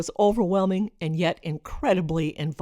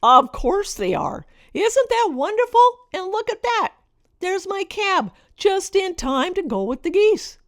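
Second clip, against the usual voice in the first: put-on much higher, sing-song voice talking down to a child; patronizing mommy voice; 11 semitones higher, 3 dB louder than usual. Mother humoring her daughter before the cab, patronizing sing-song. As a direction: humor the little one, sweetly condescending. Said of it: "in my best patronizing mommy voice".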